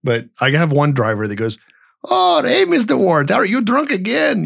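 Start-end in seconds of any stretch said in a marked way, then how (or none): 2.1-4.4 mock Indian accent, pitched way up high; bad Indian accent. Imitating his Amazon driver greeting him, in a hammed-up Indian accent. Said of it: "I know it's a really bad accent".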